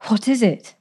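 hushed, breathless fear. Acted. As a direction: ask quickly, afraid of the answer. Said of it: "asked breathlessly".

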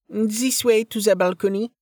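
put-on high-pitched accented sales patter; high, lilting estate-agent voice with an accent; chatty realtor. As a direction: play a foreign-accented realtor giving a measured tour. Realtor character resumes the tour.

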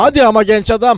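dubbing actor impression. Shown as a demonstration. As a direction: project it like a dubbed film buddy chiding a friend. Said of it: "Biraz Mustafa gibi olur musun". (abartılı, yüksek sesli dublaj taklidi)

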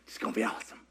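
voiceless whisper, low; whispered aside. Acted. Preacher whispering the promise to the congregation. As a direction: breathe it out as a secret.

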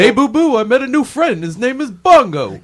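high, loud cartoon bear; big goofy cartoon bear voice; Yogi Bear voice. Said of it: "the bear voice".

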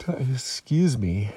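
slow, yawned apology; yawning. Real, not acted. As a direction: let a yawn drag the words. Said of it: "Excuse me for my yawns".

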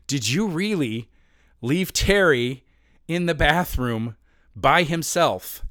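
slow, incredulous mock fury; retold angry confrontation, heavily drawn out; furious friend imitated. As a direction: spell out the accusation slowly, word by word. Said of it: "he was furious".

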